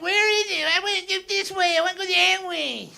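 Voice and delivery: whiny snow complaint. Retold or imitated.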